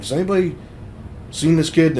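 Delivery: calling to whoever comes out. Retold or imitated. Calling out to neighbours.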